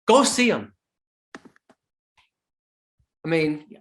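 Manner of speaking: usual speaking voice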